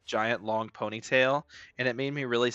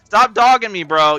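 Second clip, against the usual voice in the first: high, loud yell; 8 semitones higher, 12 dB louder than usual. Real, not acted. At players who keep hitting, loud yelling. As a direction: shout at them to stop.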